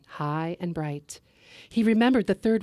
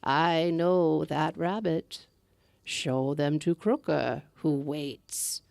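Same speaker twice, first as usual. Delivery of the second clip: put-on old woman in green; slower than usual. Second old woman gives flowers.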